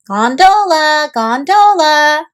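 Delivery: slow, drawn-out sing-song call. Acted. Olivia calling gondolas from the canal, slow call.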